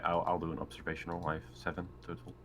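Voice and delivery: normal talking voice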